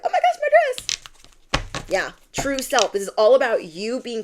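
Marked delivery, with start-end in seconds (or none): 0.0-1.8 very high, slow squeal of delight; delighted princess. Retold or imitated. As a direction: gush over the twirling dress. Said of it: "she's like".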